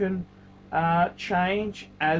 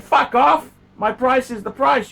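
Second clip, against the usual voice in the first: louder, higher snapped reply; angry retort; 5 semitones higher, 5 dB louder than usual. Retold or imitated. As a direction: tell the buyer to get lost. Quoting his angry reply.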